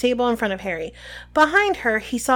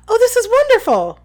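very high, loud squeal of delight; high-pitched, thrilled outburst; delighted exclamation; 12 semitones higher, 7 dB louder than usual. Acted. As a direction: gush with excitement. Voices Hermione's delighted outburst.

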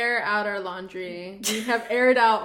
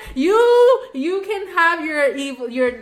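dismissive retort; 5 dB louder than usual. Retold or imitated. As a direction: shrug her off, loud and over it. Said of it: "And I was like".

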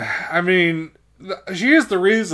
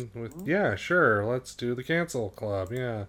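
much softer, hesitant yes; timid agreement; 2 semitones lower, 10 dB quieter than usual. Real, not acted. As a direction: agree quietly, a little unsure. Hesitant agreement to start segment.

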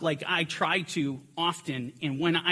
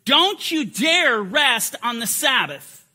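slow, louder mock scolding; stern rebuke; 4 semitones higher, 7 dB louder, slower than usual. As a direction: scold the listener, voicing Ignatius's message. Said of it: "the message that he is conveying right here is".

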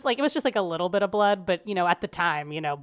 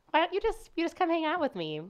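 sweet high invitation; 10 semitones higher than usual. Retold or imitated. Inviting outcasts to hang out.

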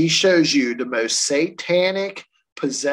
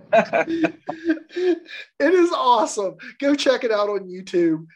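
ordinary talk, nothing marked

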